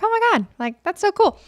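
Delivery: high, gushing excitement; thrilled gushing; excited. Retold or imitated. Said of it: "where I'm like".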